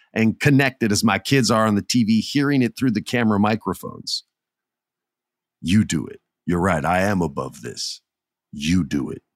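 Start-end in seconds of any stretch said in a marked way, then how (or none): 5.6-9.2 retold command. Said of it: "He says".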